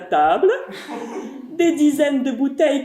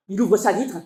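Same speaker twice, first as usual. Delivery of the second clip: plain reading voice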